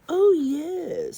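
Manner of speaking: high, loud boastful oh yes; boastful dragon. Acted. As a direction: brag it out proudly.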